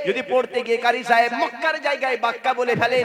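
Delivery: ordinary talk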